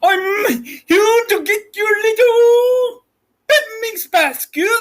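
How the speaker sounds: high, slow shouted bear boast; be the bear after the basket; hammed-up cartoon bear. Child plays Yogi stealing basket.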